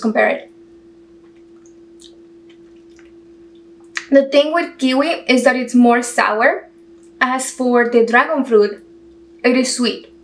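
speaking between bites; talking while chewing. Real, not acted. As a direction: mouth partly full of fruit. Seen 3: bites fruit just before, speaks while chewing, takes another spoonful.

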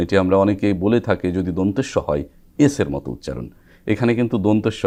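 ordinary talk, nothing marked

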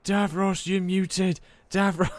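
mock calling out to someone who can't hear; calling a muted friend. Friend imitating calling to a muted teammate.

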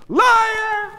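very high, loud accusing shouts; furious screaming. Acted. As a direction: scream accusations at a cheating husband.

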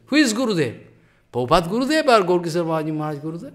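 ordinary talk, nothing marked

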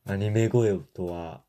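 ordinary talk

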